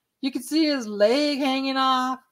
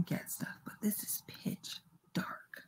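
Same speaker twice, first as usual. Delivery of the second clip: soft, fading whisper; hushed whisper; 6 semitones lower, 16 dB quieter than usual. Real, not acted. Investigator in pitch dark shushing the dog, whispering. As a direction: drop to a faint whisper.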